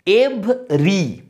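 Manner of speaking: "এভরি" slowly split into two syllables. Shown as a demonstration. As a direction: sound out "এভরি" in two separate beats. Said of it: "যদি আমরা হিসেব করি".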